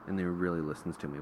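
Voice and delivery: usual speaking voice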